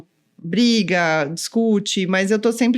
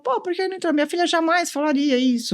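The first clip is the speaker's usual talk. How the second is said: higher, lightly voiced incredulous dad; imitated disbelieving father; 7 semitones higher than usual. Father questioning why his daughter won't go in, mildly incredulous. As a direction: play a mildly protesting parent, pitched up.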